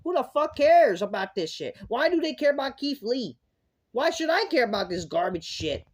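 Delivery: high, loud exasperated questions; fed-up ranting; angry rant. Real, not acted. Angry rant about the segment.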